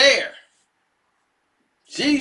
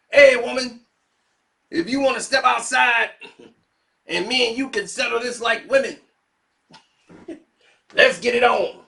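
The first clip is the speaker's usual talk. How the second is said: mock challenge; 4 semitones higher, 4 dB louder than usual. Mister T challenging to fight.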